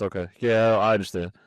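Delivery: ordinary delivery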